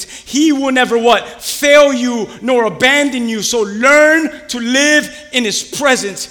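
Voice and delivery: loud, rising sermon cadence; preaching at full force. Acted. Pastor preaching hard to his congregation, loud and fervent. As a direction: preach it out to the church.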